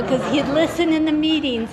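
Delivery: ordinary delivery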